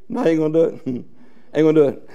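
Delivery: low, soft aside with a chuckle; muted, low-pitched dismissal; quiet refusal. Acted. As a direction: drop right down and refuse under your breath. Preacher's aside refusing the plea.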